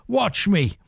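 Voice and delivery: a mild mock shout. Pirate calls to his men on the boats, mild shout. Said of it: "he shouted to his men".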